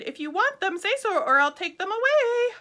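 high, loud taunt; boy's challenge. A narrator's reading. Boy daring the tigers, high and loud. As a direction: dare them cheekily. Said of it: "Then little Babaji said".

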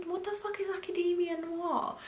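high, baffled disbelief; incredulous. Retold or imitated. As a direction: ask it totally baffled. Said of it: "I was like".

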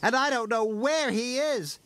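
high, worried little engine; high-pitched distress; Skarloey crying out. A narrator's reading. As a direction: fret in a high character voice. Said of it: "Skarloey cried".

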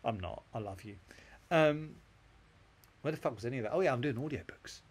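normal talking voice

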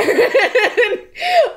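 high squealing laugh; a real laugh bursting out high; burst of laughter. Real, not acted. Laughing hard about her dogs.

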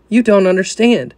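anxious protest. Retold or imitated. Sinner protesting to Jesus.

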